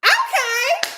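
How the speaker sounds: high shouted "Okay" rising; surprised shout. Real, not acted. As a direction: exclaim it, pitch way up.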